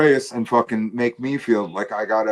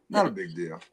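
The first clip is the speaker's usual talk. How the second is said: usual speaking voice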